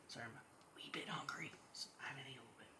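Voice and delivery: soft hurried whisper aside. Real, not acted. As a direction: whisper it as an apology. Aside about being hungry.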